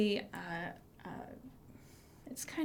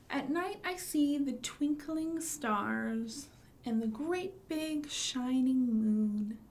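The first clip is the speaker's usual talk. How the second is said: finger play rhyme; 3 semitones higher than usual. Shown as a demonstration. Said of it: "and it goes like this".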